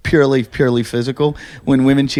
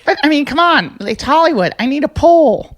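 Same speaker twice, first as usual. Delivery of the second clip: put-on whiny young woman; 11 semitones higher than usual. Co-host mimicking the girl who wants a pool, whiny and high. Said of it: "And she goes".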